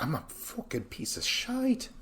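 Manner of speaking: high-pitched, badly faked accent; bad Kyle impersonation. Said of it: "this is a very bad impersonation".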